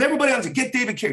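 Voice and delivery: high, fast call to the crew; director calling out. Retold or imitated. Tarantino calling actors to places, fast and high.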